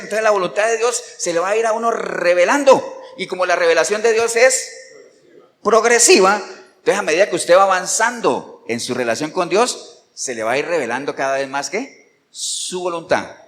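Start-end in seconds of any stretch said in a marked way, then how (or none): none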